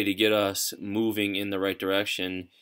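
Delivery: ordinary talk, nothing marked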